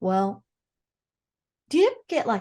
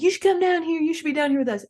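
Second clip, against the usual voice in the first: imitating eager invitation; 8 semitones higher, 5 dB louder, faster than usual. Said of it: "Then she's like".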